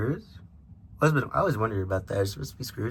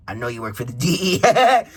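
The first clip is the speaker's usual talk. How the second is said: mock plea rising louder into a laugh; breaking into laughter; 5 semitones higher, 5 dB louder than usual. Mario accusing Luigi in a joke, cracking up laughing. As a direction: start in character, crack up on the last letters.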